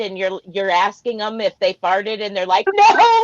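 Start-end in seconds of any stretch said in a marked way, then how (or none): 2.7-3.3 high, loud mock denial; high-pitched giggly kid fibbing; guilty little kid. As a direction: imitate a kid caught out, pitched way up. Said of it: "like the little kid in the back seat that farted".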